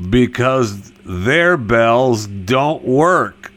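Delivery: ordinary delivery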